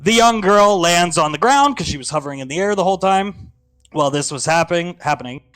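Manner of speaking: ordinary talk, nothing marked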